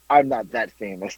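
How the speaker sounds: laughing, mock-humble brag; cringing at own words, laughing; laughing through it. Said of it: "I don't even know why I said it".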